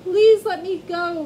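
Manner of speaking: high, loud pleading cry; frightened mouse crying out to the lion. Acted. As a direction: beg at the top of your voice. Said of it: "Mouse cried".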